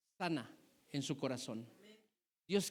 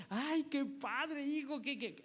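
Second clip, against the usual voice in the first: doting mother; 6 semitones higher, slower than usual. Retold or imitated. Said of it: "tu niño de ocho años".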